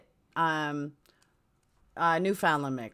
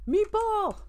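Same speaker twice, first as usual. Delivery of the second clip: high mock holler; playful impression, called out high; Archie Bunker. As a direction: holler it like a sitcom grouch, pitched way up. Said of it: "I liked Archie Bunker".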